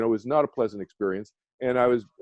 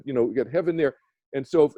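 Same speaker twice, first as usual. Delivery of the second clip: usual speaking voice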